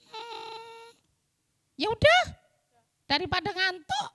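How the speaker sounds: grudging high puppet voice; Pinky puppet voice. Acted. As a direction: agree reluctantly as the puppet.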